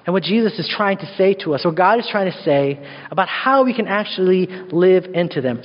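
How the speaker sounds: ordinary delivery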